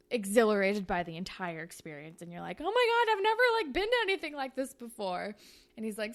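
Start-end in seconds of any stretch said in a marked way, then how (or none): 2.6-5.4 higher and louder, bursting with excitement; thrilled gasp. Acted. New girl watching a street race, thrilled and much higher. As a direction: squeal it like you've never felt this rush.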